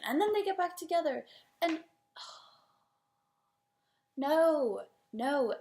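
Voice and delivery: high, loud recap collapsing into a sigh, then a loud "no"; fed-up exasperation. Real, not acted. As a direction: pile it on, sigh, then refuse emphatically. Fed up with breakup makeups.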